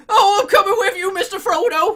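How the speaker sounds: high, loud, over-eager sidekick cry; hammed-up loud pleading quote; mock Sam. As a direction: imitate a desperate follower calling out. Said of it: "and just going".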